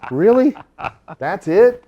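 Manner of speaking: high, loud relieved disbelief; relieved surprise. Retold or imitated. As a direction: burst out with relief. Relieved reply in retold story.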